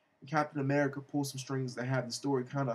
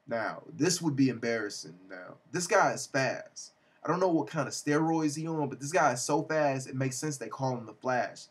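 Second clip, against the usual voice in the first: usual speaking voice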